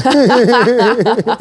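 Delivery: bursting out laughing loudly; big loud laugh; loud burst of laughter. Real, not acted. Laughs at the fibres joke.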